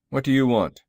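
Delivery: stern man's forceful challenge; stern, gruff. A narrator's reading. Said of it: "in a stern, gruff accent".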